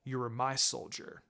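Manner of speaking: a hint of a stern teacher, quiet and steady; firm mentor. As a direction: lay down the law without raising the voice. Kakashi asserts authority.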